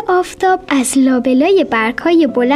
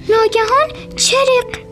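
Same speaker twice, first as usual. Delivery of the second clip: sudden crack; 9 semitones higher than usual. A narrator's reading.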